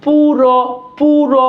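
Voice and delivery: "পুরো" repeated with the final o held; deliberate, sustained "পুরো" for a class; pronunciation demo. Shown as a demonstration. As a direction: dwell on the vowels of "পুরো". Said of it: "অ উচ্চারণ হচ্ছে".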